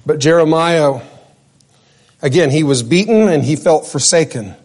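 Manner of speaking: ordinary talk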